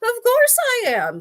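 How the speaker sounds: high, loud, slow admission; brazen boast. A narrator's reading. Crocodile answers the shaking monkey, brazenly boasting. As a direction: crow it with shameless relish.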